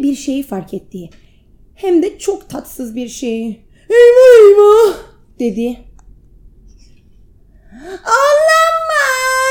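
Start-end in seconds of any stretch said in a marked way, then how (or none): 3.9-5.0 much higher, loud panicked cry; alarmed cry. Acted. 8.0-9.4 much higher, slow anguished cry; hit hard crying out. Acted. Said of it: "acı bir çığlık kopardı".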